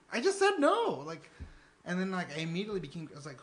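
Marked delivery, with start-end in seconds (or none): 0.1-1.0 high, loud irritated retort; exasperated. Retold or imitated. Students ask again, annoyed raised retort. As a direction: bite back, fed up. Repeated rude question.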